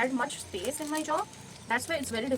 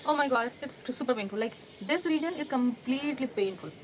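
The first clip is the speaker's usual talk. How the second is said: normal talking voice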